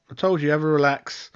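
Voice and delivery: ordinary talk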